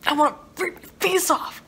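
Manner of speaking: high, strained mock agony; exasperated. Reader acting out fury at pretentious characters, high exasperation. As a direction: push it up high as if driven mad.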